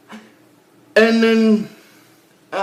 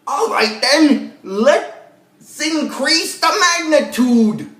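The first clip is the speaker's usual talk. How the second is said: hammed-up villain quote, rising high and drawn out; slow, high mock-villain proclamation; Zed; 4 semitones higher, much slower than usual. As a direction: imitate a scheming villain, stretching the words slowly as pitch climbs. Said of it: "he's like".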